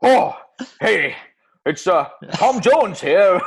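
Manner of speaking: loud, slow Welsh celebrity greeting; Welsh accent. Acted. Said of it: "Bloody Welsh accent again".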